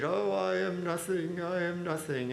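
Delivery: put-on wail, stretched and lifted; cantor impression. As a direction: play a cantor following suit in showy humility. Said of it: "the cantor comes up and follows suit".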